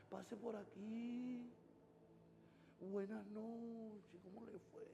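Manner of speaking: very soft, slow, overly gentle pastor voice; speaking softly. Retold or imitated. Pastor mocking how he tiptoes around touchy members, very soft. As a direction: coax them in with exaggerated softness. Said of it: "Uno habla bajito".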